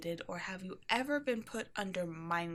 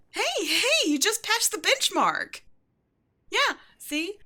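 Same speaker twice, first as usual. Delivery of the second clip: high, loud burst of delight; excited cheer; 11 semitones higher, 9 dB louder, slower than usual. Acted. Shopkeeper congratulating the new hire, excited loud cheer. Boss celebrating employee's benchmark.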